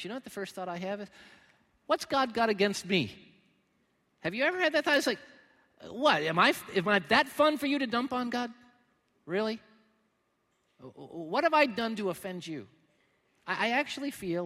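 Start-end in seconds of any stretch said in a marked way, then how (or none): 1.8-3.1 loud, higher protest at God; mock outrage, thrown upward; indignant complaint. Preacher voices his own complaint. 5.9-12.7 loud, much higher indignant cry; hammed-up grievance at heaven; outraged protest. Continues protest to God.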